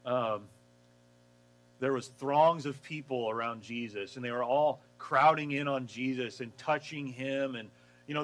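normal talking voice

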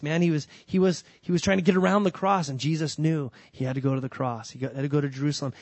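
normal talking voice

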